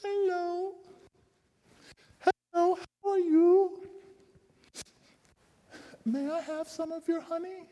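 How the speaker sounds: tiny high creature voice; an unseen little being asking sweetly for honey. A narrator's reading. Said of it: "they heard a little voice saying".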